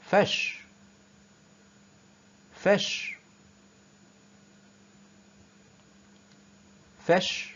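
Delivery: فش with a long soft sh; sh sound drill. Shown as a demonstration. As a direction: hold the sh in فش, nearly whispered. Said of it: "ال اس اتش بتتنطق شين". (نطق فش بشين ممدودة)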